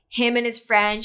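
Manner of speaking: ordinary delivery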